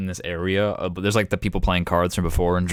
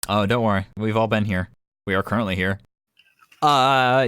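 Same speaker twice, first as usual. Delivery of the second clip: normal talking voice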